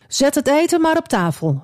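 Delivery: high call across the room. Acted. Jozef ordering the meal served, high call. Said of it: "riep hij".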